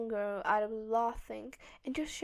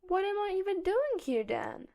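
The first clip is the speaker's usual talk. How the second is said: playfully sulky, higher and louder; mock disappointment; 8 semitones higher, 5 dB louder than usual. A narrator's reading. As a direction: pretend to be hurt. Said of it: "pretending to be disappointed".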